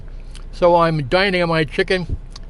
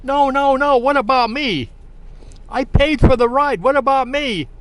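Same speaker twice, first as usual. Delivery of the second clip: mock scream; 8 semitones higher than usual. Stranded woman as her ride drives away, mock-screamed protest. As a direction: imitate her screaming protest in a raised pitch. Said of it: "she starts screaming".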